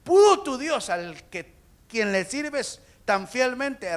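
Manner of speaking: loud, high, urgent shout; anguished, drawn-out call; crying out. Acted. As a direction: holler the question down to him. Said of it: "Y grita y dice".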